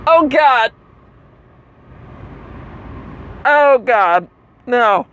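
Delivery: high, acted "oh God"; high-pitched dismay. Comic imagining the scene inside the theater, high-pitched dismay. Acted reaction to disgusting place.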